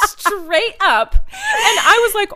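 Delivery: laughing out loud. Real, not acted. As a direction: crack up mid-sentence. Laughing retelling.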